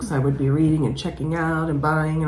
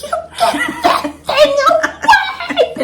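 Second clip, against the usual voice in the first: very high, slow mock shriek; grandma shrieking; 5 dB louder, much slower than usual. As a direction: shriek at the toad like a scared grandma. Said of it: "She's like".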